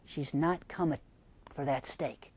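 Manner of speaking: ordinary delivery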